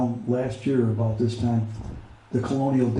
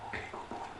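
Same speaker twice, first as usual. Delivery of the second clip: whispered praise; 21 dB quieter, much faster than usual. Real, not acted. Soothing his dog while emotional.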